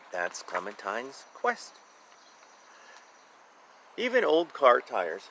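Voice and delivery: usual speaking voice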